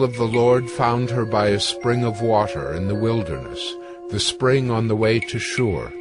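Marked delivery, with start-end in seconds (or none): none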